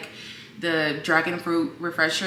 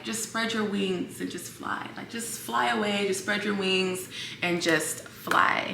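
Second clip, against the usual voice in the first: soft, tender quoted advice; gentle encouragement; 2 semitones higher, 8 dB quieter than usual. Retold or imitated. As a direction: say it softly, like a kind stranger comforting. Stranger's encouraging words quoted.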